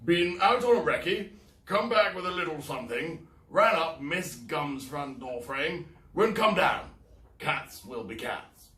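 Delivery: loud, high clipped report; military bearing. Acted. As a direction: report like an officer. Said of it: "assumed a military bearing".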